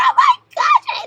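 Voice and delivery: high, loud gush of delight; real giddy outburst, very high; excited squeal. Real, not acted. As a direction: squeal it, thrilled by the treat. Delight at a gift of chocolate.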